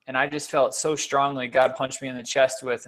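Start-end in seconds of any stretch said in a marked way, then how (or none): none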